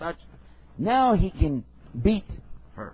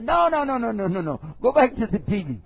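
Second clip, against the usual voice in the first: higher, louder string of no's; husband refusing, higher and louder; firm refusal; 7 semitones higher, 4 dB louder than usual. Acted. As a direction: shut it down, no no no. Husband forbids in acted quarrel.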